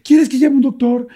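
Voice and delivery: high, urgent questioning; imitated alarm. Partner to the man who cannot breathe, high alarmed voice. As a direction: act out a worried partner pleading to help.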